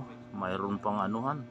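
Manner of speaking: normal talking voice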